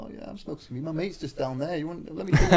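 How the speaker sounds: a hint of a dodgy bloke luring him in; shady stranger. Stranger on the estate offering weed, a hint of shady voice. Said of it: "He's like".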